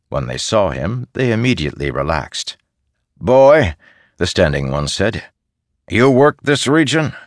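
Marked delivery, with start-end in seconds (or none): 3.2-7.1 loud, high, blunt soldier voice; gruff soldier. Acted. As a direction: question the boy bluntly. Soldier questions the boy.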